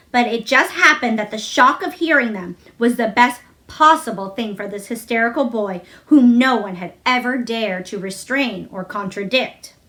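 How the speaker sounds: ordinary delivery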